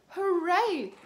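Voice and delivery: loud high cheer; big happy hooray. A narrator's reading. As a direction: cheer like a delighted little pig. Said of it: "cheers Peppa".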